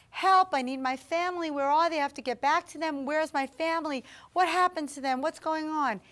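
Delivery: high, loud, urgent cry for help; retold distraught plea. A voice calling for its lost family, retold as a distraught plea. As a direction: act out a desperate plea without real sobbing. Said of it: "I'm crying while I'm saying this".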